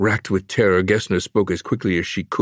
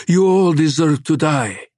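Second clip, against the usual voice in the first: higher, louder hateful pronouncement; hate-filled verdict; 8 semitones higher, 4 dB louder than usual. Acted. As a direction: spit out the death sentence. Monster pronounces doom.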